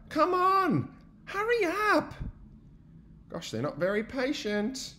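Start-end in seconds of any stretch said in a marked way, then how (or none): 0.1-2.1 impatient urging. Acted. Friends impatient.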